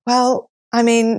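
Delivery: usual speaking voice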